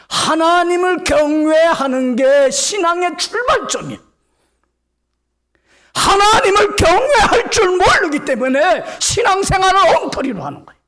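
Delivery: loud, high, fiery preaching; preaching at full force. Acted. Pastor rebuking careless faith, fiery loud preaching. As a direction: drive it home to the congregation.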